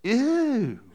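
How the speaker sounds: high, squeamish ew; a quick grossed-out ew; disgusted ew. Retold or imitated. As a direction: recoil in mock disgust. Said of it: "did any of you have this moment of like".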